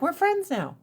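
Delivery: high, breezy mock of Japan. Imitating Japan forgiving America, high mock voice. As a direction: pitch it up, dismissive and silly. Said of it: "they're like".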